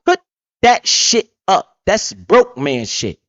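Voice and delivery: slow, scornful put-down; mock disgust at a friend's debit card. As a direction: sneer at the broke habit, slowly. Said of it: "look at you with the most disgust".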